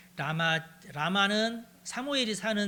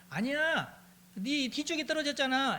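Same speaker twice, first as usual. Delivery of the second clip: calling out; 7 semitones higher than usual. Acted. Calling to the arrow boy.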